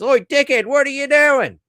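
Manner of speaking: loud, high-pitched yobbo yell; yobbo supervisor. Acted. Supervisor yelling voice commands at a robot, yobbo shout. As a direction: holler it like a yobbo boss. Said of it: "Wonder if he speaks fucking yobbo".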